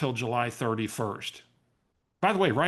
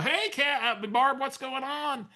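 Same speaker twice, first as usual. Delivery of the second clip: much higher, stretched friendly hello; overeager greeting; 12 semitones higher than usual. Retold or imitated. Retelling the greeting to a caller he can't place, high and overeager. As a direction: bluff your way through the hello.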